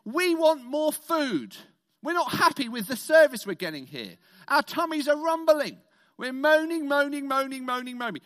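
loud, whiny mock complaint; hammed-up grumbling crowd; moaning complaint. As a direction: whine it like a hungry crowd. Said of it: "they go up to Moses and Aaron and they say".

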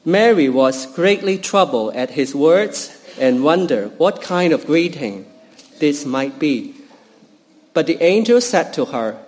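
usual speaking voice

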